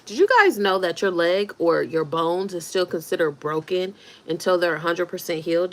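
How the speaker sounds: ordinary talk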